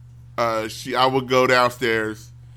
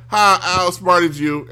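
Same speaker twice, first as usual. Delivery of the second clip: put-on gloating kid; 5 semitones higher, 5 dB louder, slower than usual. Kid gloating in retold story.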